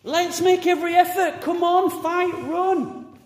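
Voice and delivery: high, loud rallying cry; shouted exhortation, pitched well up; rousing encouragement. Acted. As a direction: urge them on like a coach at a race. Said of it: "And then encourage".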